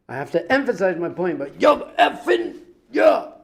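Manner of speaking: high, loud, hammered-out emphasis; over-emphatic mock shout. Rabbi mocking someone who curses to make a point, exaggerated shouting. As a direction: build into a shouted caricature of forceful cursing.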